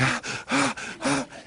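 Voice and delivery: high-pitched mock panting; imitated exhausted gasping. Runners collapsing at the porch, imitated exhausted gasps. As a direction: gasp as if worn out from running. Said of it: "they just dropped dead almost".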